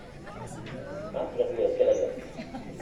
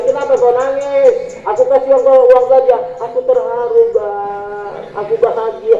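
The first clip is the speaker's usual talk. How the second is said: acted couple; 4 semitones higher, 5 dB louder than usual. Acting husband and wife dialogue.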